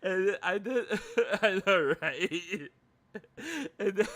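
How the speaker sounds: loud, high helpless laughter through the words; real laughing fit while talking; cracking up. Real, not acted. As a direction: barely get it out for laughing. Cracking up over own story.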